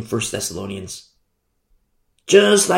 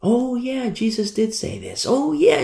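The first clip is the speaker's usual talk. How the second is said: high, softer voice of remembering; dawning realization; 9 semitones higher, 4 dB quieter than usual. Retold or imitated. Disciples putting two and two together, dawning realization. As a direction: play the penny dropping. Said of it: "started to put two and two together".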